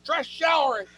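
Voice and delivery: high, sassy put-on voice; prissy dismissal of a smelly hippie; sassy brush-off. Comic snubbing a hippie attacker, high sassy voice.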